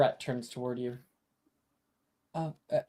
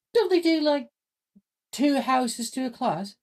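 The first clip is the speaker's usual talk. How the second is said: put-on high girl voice; 13 semitones higher, 8 dB louder than usual. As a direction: squeak it out like a young girl. GM voices Saoirse.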